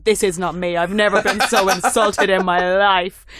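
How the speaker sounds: loud, hammed-up indignation; mock outrage. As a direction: play the insulted star, big and loud. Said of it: "So I wrote back going".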